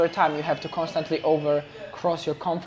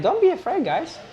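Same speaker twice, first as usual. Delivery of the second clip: encouraging call; 13 semitones higher, 3 dB louder than usual. Real, not acted. Encouraging the audience.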